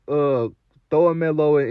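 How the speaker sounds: usual speaking voice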